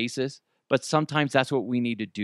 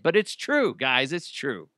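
ordinary talk, nothing marked

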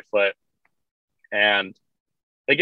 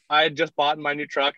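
usual speaking voice

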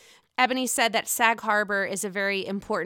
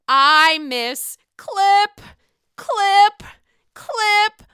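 loud drawn-out "clip, clip, clip", climbing higher each time; Dorinda catchphrase; 9 semitones higher, 7 dB louder, much slower than usual. Retold or imitated. Quoting Dorinda's catchphrase loudly.

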